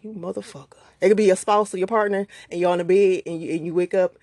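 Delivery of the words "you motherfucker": softer, muttered through a clenched mouth; mock angry grumble under the breath; tight-mouthed mutter. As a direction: hiss it at the partner half-awake. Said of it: "you wake up with a tight mouth".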